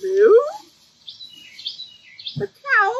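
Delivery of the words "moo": louder, higher curious moo; questioning cow. Acted. Reader voices cow spotting a farmer's sign, questioning moo. Cow sees car for sale.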